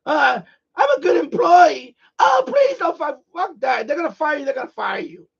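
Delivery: high, loud, hammed-up pleading; mocking a scared worker begging to keep the job; mock eager employee. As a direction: squeal it like a panicked yes-man. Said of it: "trying to prove to people".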